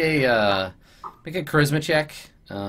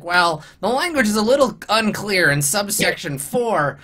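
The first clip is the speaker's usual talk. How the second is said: fussy goblin lawyer; 7 semitones higher than usual. Acted. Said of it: "he's like".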